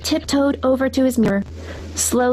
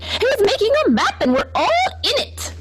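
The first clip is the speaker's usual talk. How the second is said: very high, eager group cry; excited shout; 13 semitones higher than usual. A narrator's reading. Farm animals shout to Mister Brown, very high and excited. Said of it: "quickly shouted".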